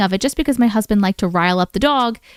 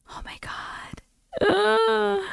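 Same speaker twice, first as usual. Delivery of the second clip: whispered shock; 12 semitones higher, 7 dB quieter, much slower than usual. Real, not acted. Reaction to scary story.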